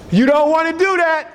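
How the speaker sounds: high-pitched acted admonishment; warning call. Wisdom's warning in acted dialogue.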